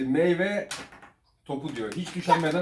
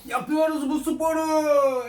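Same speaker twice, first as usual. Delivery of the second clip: silly voice; 13 semitones higher, 5 dB louder, much slower than usual. Pretend. Dad clowning at video start.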